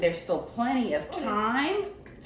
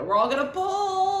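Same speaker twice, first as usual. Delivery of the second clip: high, excited team call; rallying call; 11 semitones higher, 4 dB louder than usual. Acted. Librarian rallying the toy helpers, high and excited. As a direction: hype up the helpers.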